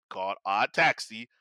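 usual speaking voice

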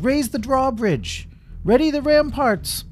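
high, loud battle orders; commanding shout. Acted. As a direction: shout urgent orders to the whole castle. King gives battle orders.

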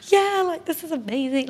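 giddy excitement. Retold or imitated. Imitating her giddy self after the date, gushing. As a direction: squeal it like you're swept away. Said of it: "I was starting to get all like".

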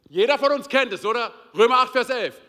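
loud, driving preacher's build; preaching at full force. Acted. Preacher proclaiming the one true helper, fervent. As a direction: proclaim it to the whole hall. Preaching climax, Romans eight.

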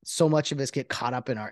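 ordinary talk, nothing marked

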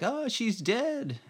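high, drawn-out maid's wail; mock scream. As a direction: imitate a shriek without the volume. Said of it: "one of the maids from the other room scream".

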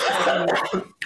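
coughing fit from choking. Real, not acted. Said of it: "I'm choking".

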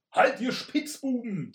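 tinny shout from a helmet. Acted. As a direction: boom the challenge out of a helmet. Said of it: "tönte es blechern aus dem Helm heraus".

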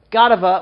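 Godiva said loud as GOT-uh-vuh; rumored pronunciation. Shown as a demonstration. Host listing the product's possible names, Godiva as loud GOT-uh-vuh. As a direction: punch the first syllable of Godiva, GOD-uh-vuh. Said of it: "I've heard it's pronounced Godiva".

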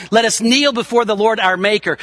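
normal talking voice

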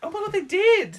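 high, loud gasp of realisation; surprised. Real, not acted. Co-host suddenly realises the Beatles cameoed, high and amazed. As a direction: blurt out amazed.

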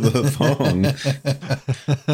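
slow, laughing question. Real, not acted. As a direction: ask while laughing. Laughing at the prank idea.

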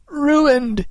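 high, wailing cry of dismay; anguished cry. A narrator's reading. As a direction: cry out in despair. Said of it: "he cried in anguish".

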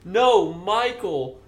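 slow, patient correction. Retold or imitated. Friend retelling how he corrected the drunk girl, slowly. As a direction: spell it out for a drunk. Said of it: "And I'm like".

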